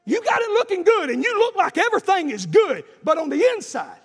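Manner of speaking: high, loud revival exhortation; full-force preaching. Acted. Preacher confronting congregation about their mess, full-force preaching. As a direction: burst into fervent pulpit cries.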